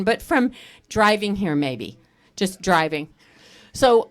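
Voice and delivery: ordinary talk, nothing marked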